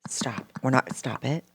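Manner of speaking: a hushed, whispered plea to stop; imitating a wife hushing her husband on the couch. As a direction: hiss it low so nobody hears. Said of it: "she's looking down".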